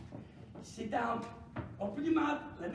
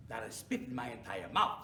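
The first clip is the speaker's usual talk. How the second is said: ordinary delivery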